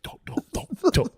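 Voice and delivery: from whisper to high squeal; begging him not to, panicking; pleading. Retold or imitated. Pleading with his buddy, whisper to squeak. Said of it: "I was like".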